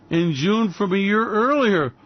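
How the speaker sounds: ordinary talk, nothing marked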